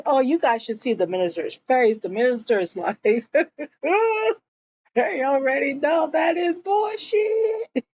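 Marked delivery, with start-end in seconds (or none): 3.0-7.9 talking through real high laughter; real laughing, high-pitched. Real, not acted. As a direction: laugh it out while saying it. Laughing while recapping.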